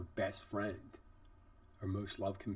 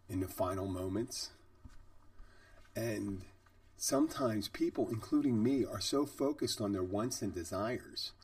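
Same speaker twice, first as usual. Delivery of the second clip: normal talking voice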